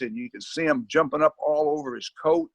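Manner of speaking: ordinary delivery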